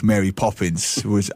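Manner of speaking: ordinary delivery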